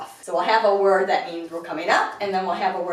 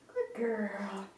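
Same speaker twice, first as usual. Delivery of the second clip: soft praise; 10 dB quieter than usual. Real, not acted. Calm praise for the puppy.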